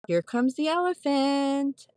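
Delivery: high, slow playful "here comes the elephant"; doctor's warning. Retold or imitated. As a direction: sing it out like a doctor warning a patient. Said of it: "they push down".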